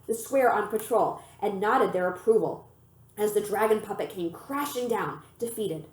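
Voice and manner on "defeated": usual speaking voice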